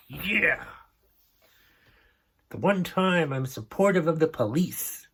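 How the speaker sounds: high, gleeful cheering for the cop; mock cheer. Host retelling cheering as a cop pulls a speeder over, gleeful mock cheer. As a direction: cheer on the police with glee. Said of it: "and I'm like".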